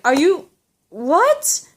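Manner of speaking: rising, incredulous what; shocked disbelief. Retold or imitated. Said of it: "I was like".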